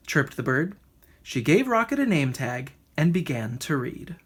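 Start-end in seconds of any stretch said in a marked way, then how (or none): none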